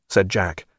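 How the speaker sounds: ordinary delivery, nothing marked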